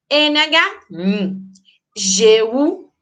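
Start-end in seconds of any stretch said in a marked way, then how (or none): 0.9-1.4 "n" as the single NH sound, hummed without vowel; isolated digraph sound. Shown as a demonstration. Teacher showing the single sound of N H, isolated digraph sound. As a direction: say just the palatal "n". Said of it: "duas letras que indicam apenas um".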